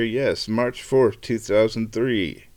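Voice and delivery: slow, drawn-out confirmation; slow confirming. Real, not acted. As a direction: read the date out slowly, satisfied. Confirms the date once he reads it.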